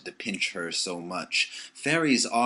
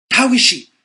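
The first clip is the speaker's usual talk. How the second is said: anxious cry; 15 dB louder than usual. A narrator's reading. Anxious moment, Peter asks.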